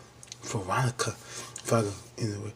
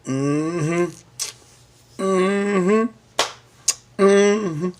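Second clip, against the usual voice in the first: drawn-out, knowing mm-hmms climbing higher; a sly, teasing hum that rises each time; 6 semitones higher, much slower than usual. Retold or imitated. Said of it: "I was like".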